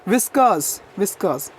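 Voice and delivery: व्हिस्कर्स repeated with no r, "viskuhs"; silent r. Shown as a demonstration. Teacher drilling the corrected word, व्हिस्कर्स with the r silent. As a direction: model व्हिस्कर्स with a dropped r. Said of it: "व्हिस्कर्स बोलना है".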